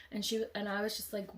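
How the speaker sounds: normal talking voice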